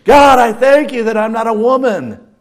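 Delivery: loud, proud declaration to God; proud prayer. Retold or imitated. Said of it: "And then he would say".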